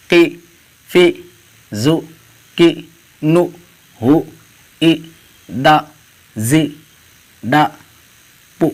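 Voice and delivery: "او، جی، بو" as separate syllables, a pause after each; syllable reading drill. Shown as a demonstration. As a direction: read "او، جی، بو" one syllable at a time without spelling it out. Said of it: "اس طرح پڑھیے". ("او، جی، بو" بغیر ہجے کے، الگ الگ، وقفے سے)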